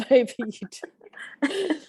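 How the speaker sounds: laughing while answering; reply through a laugh, pitch jumping up. Real, not acted.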